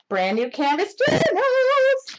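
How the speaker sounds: high, drawn-out excited squeal of news; shouted reveal, soaring at the end; excited announcement. Real, not acted. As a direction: stretch it out high, almost singing with excitement. Announcing new project to viewers.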